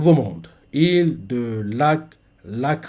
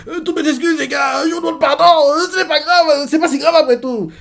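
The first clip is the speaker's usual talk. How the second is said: much higher, whiny apology; squeaky cowardly clown; grovelling Baggy; 11 semitones higher than usual. Retold or imitated. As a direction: cringe and babble excuses. Baggy grovels to his partners.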